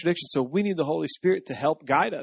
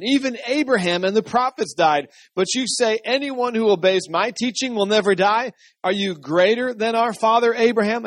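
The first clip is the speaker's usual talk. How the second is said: higher, mildly indignant reading; incredulous challenge; 6 semitones higher, 4 dB louder than usual. A narrator's reading. The people challenge Jesus over Abraham, incredulous challenge. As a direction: challenge him with a raised pitch.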